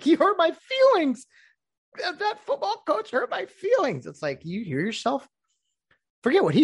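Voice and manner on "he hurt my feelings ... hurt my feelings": much higher, whiny mocking voice of a hurt player; whiny mock victim.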